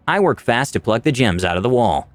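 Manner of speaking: ordinary delivery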